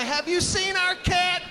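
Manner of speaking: worried neighbor. Retold or imitated. Neighbor asking around for a lost cat, high anxious call.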